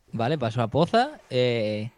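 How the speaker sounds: ordinary delivery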